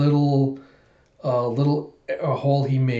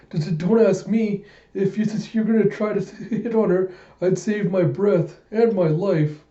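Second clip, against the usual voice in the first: higher, stuttering, a laugh mid-line, slowing at the end; stammering nervous old-timer; 6 semitones higher than usual. Acted. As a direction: stammer out a warning like a spooked old man. Nervous old-timer voiced in recap.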